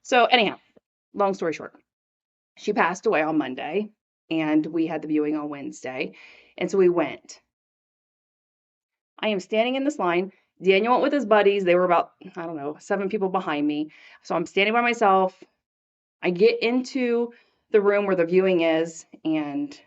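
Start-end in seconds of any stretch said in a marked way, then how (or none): none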